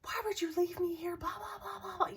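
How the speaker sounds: high-pitched retold rant; mad complaint. Telling off the friend who left her, high mock-angry rant. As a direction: imitate yourself griping, running into blah-blah. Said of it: "I was so mad".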